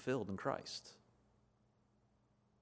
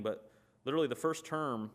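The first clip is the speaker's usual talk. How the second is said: ordinary talk, nothing marked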